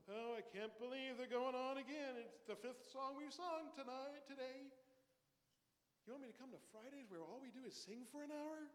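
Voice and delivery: high, loud bored whine; mock whiny churchgoer. Pastor mocking a bored worshipper at Friday service. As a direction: gripe like a churchgoer sick of singing. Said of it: "And yet we grow bored".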